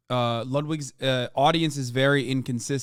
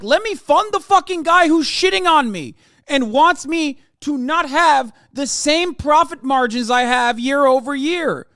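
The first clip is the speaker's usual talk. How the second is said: mocking rich man; 12 semitones higher, 8 dB louder than usual. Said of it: "be like".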